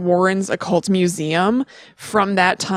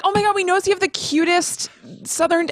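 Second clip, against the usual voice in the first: gushing locals; 9 semitones higher than usual. Retold or imitated. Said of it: "they're like".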